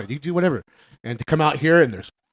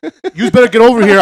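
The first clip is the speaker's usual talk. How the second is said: loud, higher New York yell of "yous"; pissed-off sister; 7 semitones higher, 11 dB louder than usual. Retold or imitated. Sister calling the kids in, loud New York yell. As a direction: bark "yous" like an angry Brooklyn sister. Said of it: "she's like".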